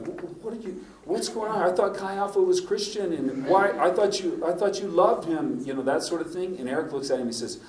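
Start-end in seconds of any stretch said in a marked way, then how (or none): 0.1-5.6 higher, bewildered young guy, imitated; confused bystander. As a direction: stammer out the confusion. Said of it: "And Stephen's like".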